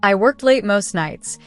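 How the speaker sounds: usual speaking voice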